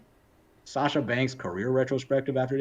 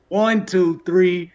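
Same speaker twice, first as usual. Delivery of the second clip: louder, higher count; excited count; 6 semitones higher, 7 dB louder, much slower than usual. Real, not acted. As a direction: count it up with rising hype. Calling the winning pin count.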